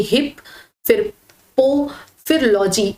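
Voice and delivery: हिप, पो, logy each set apart by a clear pause; memorising chunk by chunk, हिप... पो... logy; broken into parts. Shown as a demonstration. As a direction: slowly step through हिप, then पो, then logy. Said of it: "इस तरह से".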